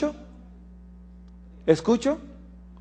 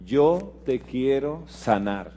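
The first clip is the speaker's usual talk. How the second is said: Dios sanador; 5 semitones lower, 11 dB quieter than usual. Acted. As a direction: let each word land gently as a divine promise. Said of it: "Dios dice". (promesa divina, suave y solemne)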